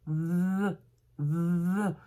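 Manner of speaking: "The" with a long buzzing th; tongue between teeth. Shown as a demonstration. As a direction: trap the tongue and hum into "The". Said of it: "on va coincer la langue entre les dents".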